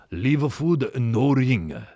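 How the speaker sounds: put-on Japanese learner's English; exaggerated Japanese accent with added vowels; mock Japanese accent. Reading his own door note aloud, mock Japanese accent. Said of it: "like a fucking Japanese guy trying to learn English".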